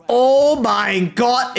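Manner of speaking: ordinary talk, nothing marked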